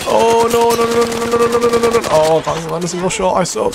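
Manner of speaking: high panicked string of no; real panic rising into self-blame. Real, not acted. About to lose.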